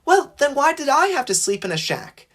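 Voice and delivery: high-pitched, indignant piping voice; high little engine objecting. A narrator's reading. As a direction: pipe up with a high protest. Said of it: "Percy piped up".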